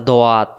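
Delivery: "দোয়াত" in two syllables, do-waat; "দোয়াত" opening out into a wide "waat"; common mispronunciation. Shown as a demonstration. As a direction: say "দোয়াত" with a W glide into an open aa. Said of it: "অনেক ভুল করি".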